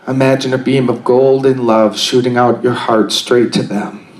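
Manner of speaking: calm, unhurried guided meditation voice. Acted. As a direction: guide them gently into stillness.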